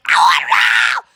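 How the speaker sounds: shouted out, low and loud; playful shout. Retold or imitated. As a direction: yell it like a kid at play. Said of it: "So I'm like".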